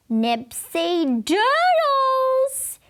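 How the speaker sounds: put-on high, slow, loud child's yell; drawn-out high shout of a snack name; loud kid yell. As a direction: belt it out like an eager six-year-old. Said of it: "he said real loud".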